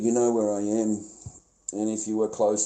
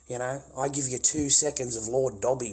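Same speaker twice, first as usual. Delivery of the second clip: ordinary delivery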